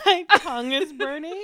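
laughing through the words. Real, not acted. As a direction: laugh while saying it. Tasting hot sauce, laughing.

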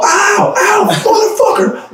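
high yelping cry of pain; retelling breaking his finger, high pained yelp; retold pain yelp. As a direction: yelp like you just broke your finger. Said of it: "And I was all".

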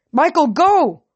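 high, loud urgent scream. A narrator's reading. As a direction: scream at him to get away. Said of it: "I screamed".

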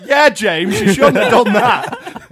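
loud, high jeer from the back; mock telling-off. Mimicking friends' mock telling-off from behind.

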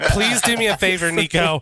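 normal talking voice

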